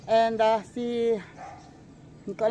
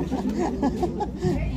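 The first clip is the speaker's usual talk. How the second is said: laugh; 4 semitones higher than usual. Real, not acted. Laughs at being filmed.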